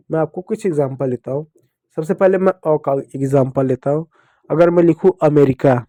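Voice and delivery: normal talking voice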